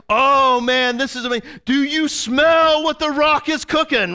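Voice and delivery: high, loud wrestler hype voice; Peter as the Rock. Retold or imitated. Peter, flexing before the crowd, in a loud hype voice. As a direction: brag like a pumped-up wrestler. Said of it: "And he's like".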